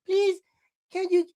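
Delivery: high, pleading mock-sweet voice; exaggerated high-pitched coaxing; wheedling baby talk. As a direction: beg like you're cooing to a toddler, squealing the last please. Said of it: "And then he said".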